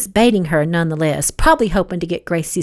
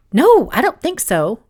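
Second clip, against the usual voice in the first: hurried, higher-pitched denial; a quick, slightly raised brush-off; 6 semitones higher, 4 dB louder than usual. A narrator's reading. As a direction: blurt the denial out. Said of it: "Gracie said quickly".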